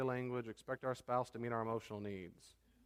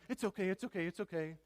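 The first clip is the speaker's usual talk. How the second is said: soothing rescuer; 6 semitones higher than usual. Retold or imitated. Acted rescuing quote.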